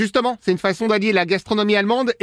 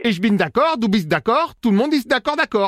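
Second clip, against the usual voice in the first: fake German accent; 2 semitones higher than usual. Prank caller posing as German brother-in-law, hammy German accent. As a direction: lay on a cheesy German accent in French. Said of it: "l'accent pourri".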